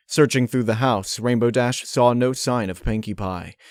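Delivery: plain reading voice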